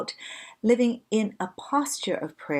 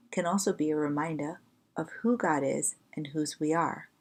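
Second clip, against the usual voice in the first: ordinary talk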